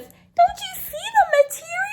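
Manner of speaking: high, squeaky mock voice of the mother; mocking mom voice. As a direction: pitch way up to mimic her. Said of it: "She says".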